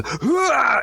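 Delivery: high, mock triumphant cry; jumping cheer. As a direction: toss off a playful hurrah. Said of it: "the long jumpers in the Olympics".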